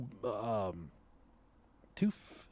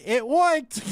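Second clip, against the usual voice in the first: put-on higher, louder little kid; the little sister piping up, higher and louder; little girl voice; 8 semitones higher, 5 dB louder than usual. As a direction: chirp it like a small child. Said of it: "She said".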